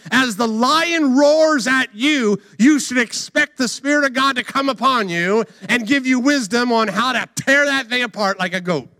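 high-energy sermon climax; impassioned preaching. Real, not acted. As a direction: build insistently to rally believers. Preaches at full force.

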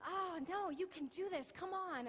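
loud, high urging shout; urging cheer. Retold or imitated. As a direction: push the kid on from below, calling up. Mother urging scared son.

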